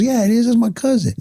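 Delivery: stubborn homie insists in a high voice; imitating stubborn homie. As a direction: pitch way up and dig in like a stubborn kid. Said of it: "He goes".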